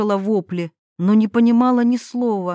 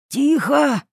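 higher, abrupt hushing call; sharp raised shout; 6 semitones higher than usual. A narrator's reading. Yuri silencing Irina in the forest, sharp shout. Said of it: "прикрикнул на неё".

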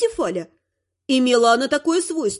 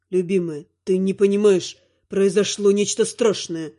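low, tender dark lord; deep male voice, frightened husband; 7 semitones lower than usual. Acted. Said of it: "упорствовал властелин". (низкий голос тёмного властелина)

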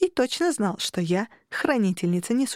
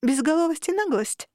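higher-pitched mock-innocent sarcastic question; a touch of sarcasm, pitched up; 7 semitones higher than usual. A narrator's reading. Guest teasing her host over her shoulder, mildly sarcastic. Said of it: "чтобы добавить саркастическое".

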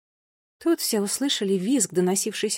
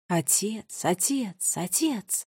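softer, fading repeats of a scream; echo of a cry; 3 dB quieter, much slower than usual. A narrator's reading. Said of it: "её крик эхом отразился от стен". (затихающее эхо крика)

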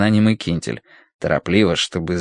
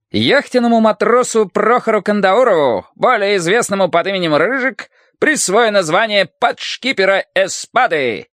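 high, loud, ceremonial proclamation; royal herald; 12 semitones higher, 3 dB louder than usual. A narrator's reading. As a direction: announce it like a court herald. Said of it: "голосом Герольда объявил Кирилл Инаков".

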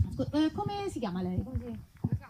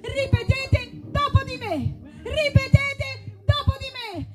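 higher, slow commanding call; fervent call; 10 semitones higher, 4 dB louder, slower than usual. Acted. Preacher commanding the congregation to repeat after her. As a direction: summon the crowd to repeat. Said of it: "call to congregation".

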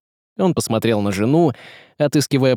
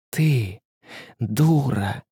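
hissed insult; 4 dB quieter, much slower than usual. A narrator's reading. Woman scolding the tsaritsa after slapping her, hissing. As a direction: hiss it, held back. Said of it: "зашипела".

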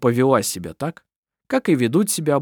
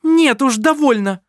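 loud, very high outburst; shouting; 13 semitones higher, 7 dB louder than usual. Acted. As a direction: scream that you've had enough. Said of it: "вскричала Керстин".

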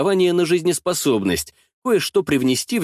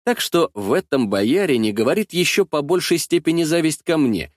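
ordinary delivery, nothing marked

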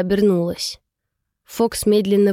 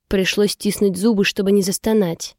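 usual speaking voice

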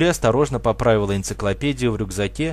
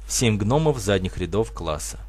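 usual speaking voice

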